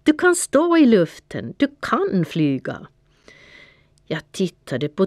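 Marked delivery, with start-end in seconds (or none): 0.0-2.9 encouraging call. A narrator's reading. Panter urging the cat that he can fly, calling. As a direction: urge him on from a distance. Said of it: "ropade han".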